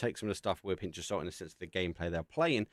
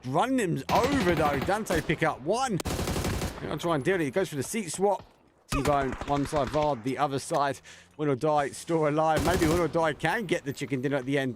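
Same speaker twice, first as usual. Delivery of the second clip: raised, driving esports commentary; live play-by-play; 6 semitones higher, 5 dB louder than usual. Real, not acted. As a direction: call the fight as it unfolds, pitch up.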